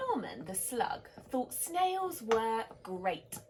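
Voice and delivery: lively picture-book reading; story narration. A narrator's reading. Reading a bedtime story to children, animated. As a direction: read it like a bedtime story to little ones.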